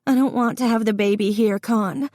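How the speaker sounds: scared, high-pitched, hurried plea. A narrator's reading. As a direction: plead quickly in a high, frightened voice. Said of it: "Her voice was high and scared".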